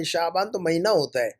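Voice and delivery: ordinary talk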